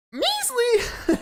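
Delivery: high indignant squeal on one word; measly voice. Retold or imitated. Friend mimicking the offended reply about his clap, high squeal. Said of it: "said it in the measliest voice".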